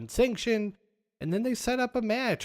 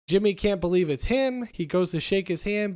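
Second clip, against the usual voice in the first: normal talking voice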